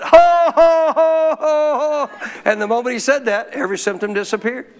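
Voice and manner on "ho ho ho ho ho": high, booming mock ho-ho-ho; put-on triumphant laugh. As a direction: start loud and let the forced laugh ease off. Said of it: "I just putting it on".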